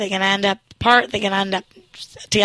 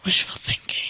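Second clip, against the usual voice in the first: whispered aside; 8 dB quieter than usual. Real, not acted. Aside after saying he's sexy.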